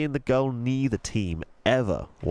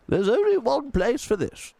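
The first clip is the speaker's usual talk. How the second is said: put-on gentle old tramp voice, a different timbre; old tramp Mister Stink; 13 semitones higher, 4 dB louder than usual. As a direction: speak like a kindly old vagrant.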